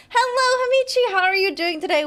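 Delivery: cheerful greeting. Real, not acted. Greeting a viewer on stream.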